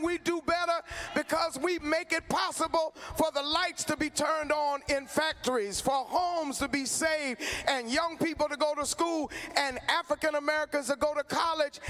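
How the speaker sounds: fiery call-and-response to a rally crowd; rally preaching. Real, not acted. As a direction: whip the crowd up, climbing each round.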